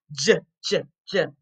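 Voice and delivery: "J" as a short, low juh; J sound. Shown as a demonstration. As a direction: separate juh beats for each "J", not the letter name. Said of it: "what's the J sound".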